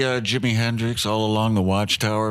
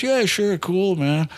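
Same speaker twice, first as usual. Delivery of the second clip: higher, easygoing agreeable voice; pitched up, casual yes to a fan; 9 semitones higher, slower than usual. Retold or imitated. Guitar hero agrees to a photo, easygoing.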